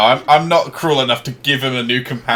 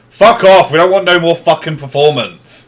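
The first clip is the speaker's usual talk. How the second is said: loud, raised-pitch pub heckle; angry heckler; 5 semitones higher, 10 dB louder than usual. Acted. Drunk punter to performer on stage, angry heckler. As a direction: bellow it at the performer. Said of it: "half skin of ale thrown at you".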